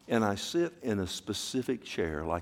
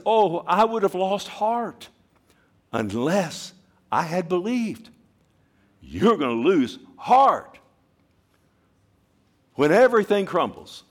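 impassioned preaching; 9 semitones higher, 8 dB louder than usual. Acted. Preacher quoting David to the church, impassioned. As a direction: cry it out from the heart. Psalm then warning.

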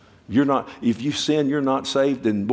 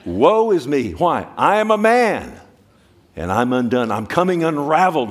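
loud, higher cry of being undone; anguished cry; 4 semitones higher, 6 dB louder than usual. Retold or imitated. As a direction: wail it out as a man coming apart. Preacher voicing Isaiah's woe.

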